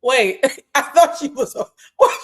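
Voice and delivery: high, loud laugh breaking into a squeaky what; cracking up in confusion; laughing disbelief. Real, not acted. Clip won't end, host laughing in disbelief. Clip keeps going, unexpectedly.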